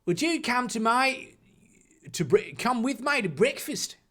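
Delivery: polite query in a put-on Australian accent; careful, slightly halting Australian accent. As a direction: ask courteously as a mock Australian. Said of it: "Ronald queried politely".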